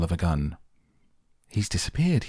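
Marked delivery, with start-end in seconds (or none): 1.5-2.3 high, alarmed cry at normal volume; storyteller's mild yell, pitched up; a mother's high cry, lightly acted. A narrator's reading. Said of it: "yelled Missus Gloop".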